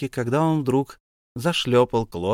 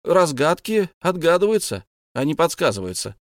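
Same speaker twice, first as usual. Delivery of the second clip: objecting; 5 semitones higher, 4 dB louder than usual. A narrator's reading. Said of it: "возразил он".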